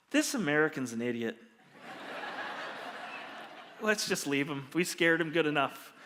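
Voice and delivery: low, offhand voice of an officer; dismissive cop. Retold or imitated. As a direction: imitate a cop dismissing someone to his crew. Cop mutters to his crew.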